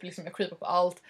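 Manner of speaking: normal talking voice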